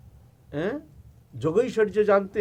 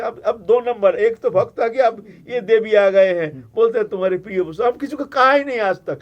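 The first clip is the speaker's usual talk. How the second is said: flustered Shiva; 4 semitones higher, 8 dB louder than usual. Acted. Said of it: "हम घबरा गए".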